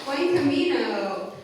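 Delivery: slow, bright call to the class; kindergarten teacher voice. Shown as a demonstration. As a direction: greet them like a kindergarten class. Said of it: "that's my, um, kindergarten voice".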